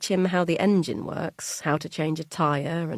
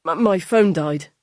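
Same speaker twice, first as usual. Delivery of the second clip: louder, stammering excuse; flustered son; 9 dB louder than usual. Acted. Son explains to his wife why he was late, flustered. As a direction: blurt out the excuse.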